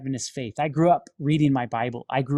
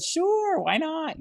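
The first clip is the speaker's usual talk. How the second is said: breezy agreement; 13 semitones higher, much slower than usual. Retold or imitated. Casually agreeing to prayer.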